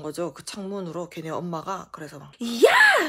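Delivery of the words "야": piercing, very high yell; retold furious mother's shout; angry shout. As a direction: yell down at a kid from a window. Mother shouts from third-floor window.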